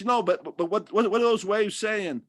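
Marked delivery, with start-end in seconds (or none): none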